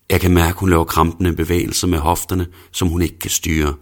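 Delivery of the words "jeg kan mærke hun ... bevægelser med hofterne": ordinary delivery, nothing marked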